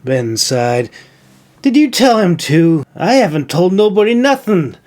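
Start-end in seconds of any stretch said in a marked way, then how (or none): 1.6-4.8 scowling. A narrator's reading. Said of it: "Milo scowled".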